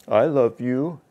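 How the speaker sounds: mismatched, hollow profession of love; incongruent declaration. Shown as a demonstration. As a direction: say it so it is not believable. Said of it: "I was totally incongruent".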